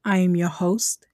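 loud, upbeat intro voice; podcast announcer. Acted. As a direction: announce the show like a host. Podcast intro over music.

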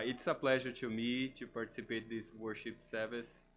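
normal talking voice

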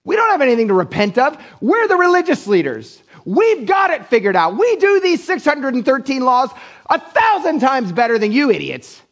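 high, sneering voice of proud leaders; haughty religious leaders. Retold or imitated. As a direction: look down your nose at the idiots. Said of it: "That's basically was their reaction".

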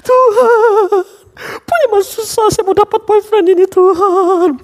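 high, loud hammed-up sobbing plea; mock wailing prayer. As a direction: wail over the boyfriend you cannot get. Imitates frustrated crying prayer.

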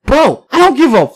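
loud, high, drawn-out mock yell; exasperated outburst. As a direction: holler the brush-off, cracking up midway. Retells his outburst, laughing.

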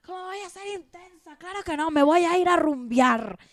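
high, indignant mock disbelief; indignant teen. Young woman to ex-boyfriend, high indignant protest. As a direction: squeak out the outrage at being called intense. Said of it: "Y yo".

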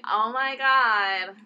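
slow drawn-out airhead; lightly voiced valley girl; bimbo voice. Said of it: "the bimbo voice".

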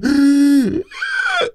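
sharp loud gasp of hype; excited gasp. Retold or imitated. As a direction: gasp like you can't wait. Hyped gasp before a movie.

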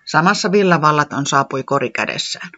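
plain reading voice

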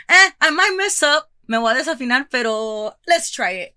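jittery but game; nervous excitement. Retold or imitated. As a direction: psych yourself up despite the nerves. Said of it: "And I'm just like".

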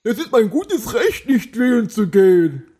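high, whiny imitation of a smug non-voter; mocking non-voter.

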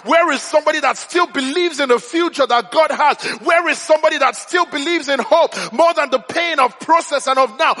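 rousing sermon, building in pitch and urgency; preacher rallying a congregation; impassioned preaching. Real, not acted. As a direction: drive each repeated question home like a preacher.

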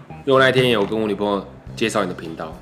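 ordinary talk, nothing marked

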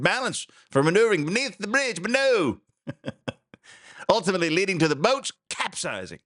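melodramatic report, pitch climbing as it goes; singsong, hammed-up news reading; mock news read.

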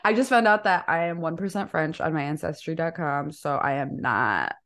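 playful put-on French accent. Shown as a demonstration. Guest boasting to host about their skills, French accent. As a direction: show off your French accent. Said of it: "I can do an amazing French accent".

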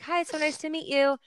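lightly lifted sweet [name] voice; imitating [name]. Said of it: "and she's like".

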